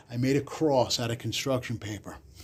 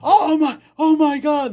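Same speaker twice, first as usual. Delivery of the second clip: high, loud hammed-up gasp of horror; mock shock; 13 semitones higher, 7 dB louder than usual. Pupil faking horror at the cross, hammed-up shock. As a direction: play innocent and shocked, way up high. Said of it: "and I said".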